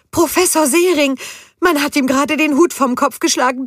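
high, loud agitated cry; excited shout. A narrator's reading. Judith seeing the professor's hat knocked off, high loud alarmed cry. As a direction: call out in alarm at what she sees. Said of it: "schrie sie aufgeregt".

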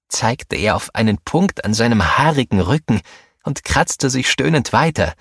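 ordinary delivery, nothing marked

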